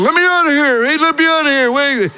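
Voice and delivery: high-pitched comic mock cries from graves; trapped people calling out. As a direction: play the buried pleading to be let out. Buried people knocking to get out.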